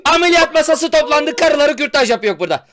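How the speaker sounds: high, loud ranting; mock outraged shout. Reporting a scandal to friends, mock outraged shout. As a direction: raise a hammed-up alarm.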